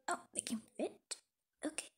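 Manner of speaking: soft unvoiced whisper. Girl packing the bag quietly, whispering. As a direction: barely breathe the words. Sneaking snacks.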